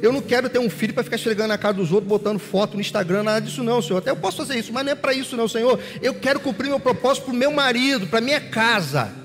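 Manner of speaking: high-pitched, insistent vow to God; impassioned praying woman. Acted. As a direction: plead her case fervently, pouring out the promise. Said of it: "porque ela tinha prometido".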